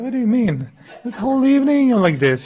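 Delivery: high, loud mock protest; incredulous retort. Retelling a reply to someone's remark, incredulous. Acts surprised denial of remark.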